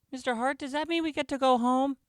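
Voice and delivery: put-on very high-pitched child's voice; eager pupil. A narrator's reading. Pupil asking teacher to go home, high child voice. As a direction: voice a young pupil, high and hopeful.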